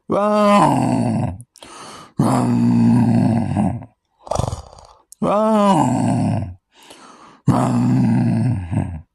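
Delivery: a kid's mock big-cat roar, drawn out; pretend roar. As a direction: roar like a young wildcat finding its voice. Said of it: "I'm ready to roar".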